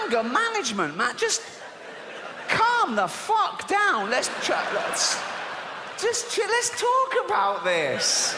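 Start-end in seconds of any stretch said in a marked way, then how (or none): 1.2-8.4 mock calming.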